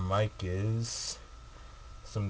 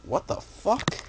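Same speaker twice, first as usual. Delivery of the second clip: shocked; 11 semitones higher, slower than usual. Real, not acted. Reading shocking hate letter.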